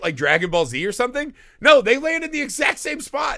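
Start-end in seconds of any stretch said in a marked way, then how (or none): 1.6-3.4 exasperated outburst. Real, not acted. Exasperated at the movie's plot.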